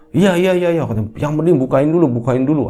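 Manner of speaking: high, hurried begging; urgent pleading. Acted. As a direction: plead to be let in right away. Father begging to be let in.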